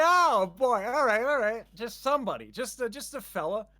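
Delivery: high, eager, then fading off; excited inner voice. Retold or imitated. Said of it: "I was like".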